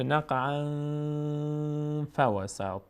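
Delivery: long hummed nasal ending on نقعاً before فوسط; nasal ikhfa. Shown as a demonstration. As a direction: hold the n of نقعاً in the nose, then فوسط. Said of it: "از بینی ادا بشه".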